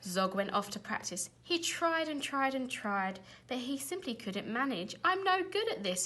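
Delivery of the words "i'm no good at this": high, despairing wail; frustrated cry. A narrator's reading. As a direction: cry out in failure. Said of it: "he cried".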